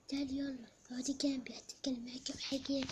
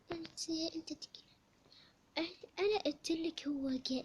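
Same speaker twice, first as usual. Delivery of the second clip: ordinary talk, nothing marked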